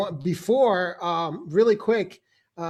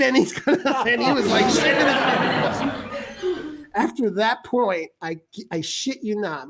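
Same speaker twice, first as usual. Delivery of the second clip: usual speaking voice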